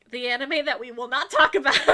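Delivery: loud laugh through the words. Real, not acted. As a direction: crack up as you say it. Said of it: "laughs at own joke".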